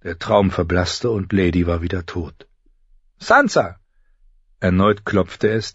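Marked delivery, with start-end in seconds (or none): 3.2-3.8 high, sharp name with falling end; sharp call. A narrator's reading. Septa knocks a second time, calling sharply. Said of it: "diesmal scharf".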